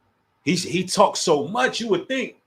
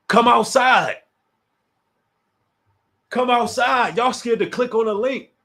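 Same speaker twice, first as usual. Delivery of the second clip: calling out; 5 semitones higher, 4 dB louder than usual. Real, not acted. Calling challengers out on stream.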